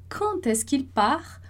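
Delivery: slow, careful French question; slow French model phrase. Shown as a demonstration. Teacher modelling the first phrase for students, slow and careful. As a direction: spell out each sound of "quand est-ce qu'il part?" for the learner. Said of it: "a nossa primeira frase lá".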